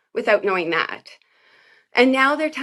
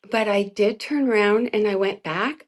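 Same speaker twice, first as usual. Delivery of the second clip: usual speaking voice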